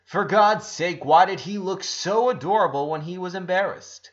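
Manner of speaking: ordinary delivery, nothing marked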